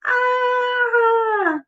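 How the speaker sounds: a high storybook shriek of fright; playful high scream. A narrator's reading.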